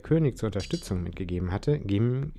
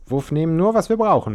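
much higher, louder warning; admonishing; 8 semitones higher, 7 dB louder than usual. A narrator's reading. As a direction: warn them sharply. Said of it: "mahnte Caruso".

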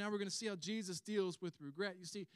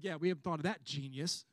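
loud, mocking retort; acted scornful fisherman; sarcastic comeback; 3 semitones lower, 8 dB louder than usual. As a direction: lay the sarcasm on thick. Acts fisherman's sarcastic comeback to stranger.

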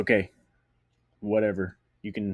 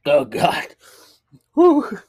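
gasping after shot; 9 semitones higher, 13 dB louder, much slower than usual. Real, not acted.

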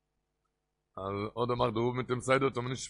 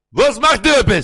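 very loud, high-pitched shouted greeting; geschreeuwde begroeting; 10 semitones higher, 17 dB louder than usual. Retold or imitated. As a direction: bellow the question at full volume. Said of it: "וואו שרייט דער רבי?".

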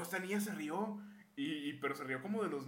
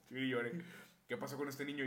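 normal talking voice

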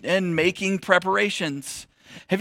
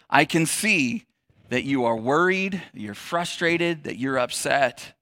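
gentle reassurance; 4 semitones lower, 5 dB quieter, slower than usual. Retold or imitated. Jesus gently naming her feelings.

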